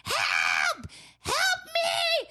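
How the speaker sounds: shrill mock scream for help, way up high; hammed-up Karen yelling for help; mocking a woman screaming for security. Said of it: "screaming bloody murder like a Karen".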